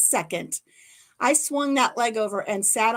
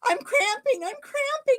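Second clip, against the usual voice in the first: much higher, urgent repeated plea; panicked pleading. Retold or imitated. Retelling the cramp on the saddle, high panicked plea. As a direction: beg for help as the legs lock. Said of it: "And I said".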